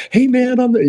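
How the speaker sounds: high, eager fanboy gush; mock starstruck fan. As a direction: play the starstruck fan blurting out. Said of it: "trying not to go".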